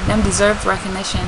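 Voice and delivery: ordinary talk, nothing marked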